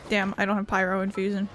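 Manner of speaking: ordinary talk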